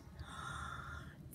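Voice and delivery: sudden breathy gasp of fright; sharp gasp. A narrator's reading. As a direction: gasp as if caught off guard. Jasper opens the drawer.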